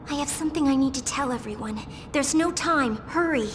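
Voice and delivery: urgent. Acted. Urgent warning to everyone.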